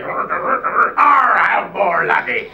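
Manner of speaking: high, loud pirate shout. Retold or imitated. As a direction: bellow it like a pirate. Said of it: "I call it meat pirates".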